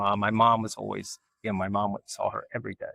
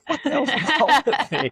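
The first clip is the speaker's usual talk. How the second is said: high, loud laughing exclaim; laughing self-mockery; 5 dB louder than usual. Real, not acted. Laughing at herself to the host, high and loud. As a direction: laugh at your own craziness.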